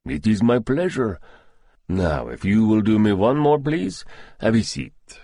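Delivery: put-on polite doctor in a thick Russian accent; courteous Russian-accented invitation to sit. A narrator's reading. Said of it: "He spoke with a thick Russian accent".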